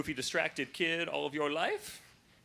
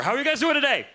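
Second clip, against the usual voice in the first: higher and louder, pumping up the crowd; energetic greeting; 10 semitones higher, 9 dB louder, much faster than usual. Shown as a demonstration. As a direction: hype the audience up. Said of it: "I'ma believe it the next time that you answer".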